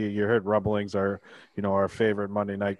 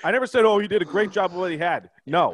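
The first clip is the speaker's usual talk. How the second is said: yelling; 12 semitones higher, 7 dB louder than usual. Real, not acted. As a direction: shout it, fed up. Heated podcast argument over quote.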